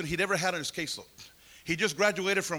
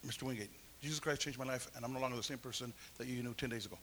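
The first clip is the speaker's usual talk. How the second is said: quiet earnest declaration; 7 semitones lower, 10 dB quieter, faster than usual. Retold or imitated. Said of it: "I looked at him and I said".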